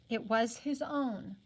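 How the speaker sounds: usual speaking voice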